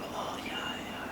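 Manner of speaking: weak, breathless whisper fading away; barely conscious, can only murmur; faint whisper. Retold or imitated. To his crying parents after blacking out, a faint whisper.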